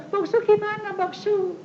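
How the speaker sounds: high-pitched mock whining appeal; whiny crybaby. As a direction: imitate a needy churchgoer whining for help. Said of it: "Saudara sedikit-sedikit cengeng".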